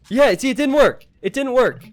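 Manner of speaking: high, loud, amazed; excited outburst. Real, not acted. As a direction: blurt it out, thrilled. Caster reacts to failed alliance.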